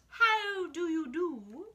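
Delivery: high, slow prim voice; high, slow and posh-polite; mock polite greeting. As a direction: stretch it out all proper. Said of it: "It's not".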